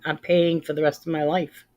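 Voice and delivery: normal talking voice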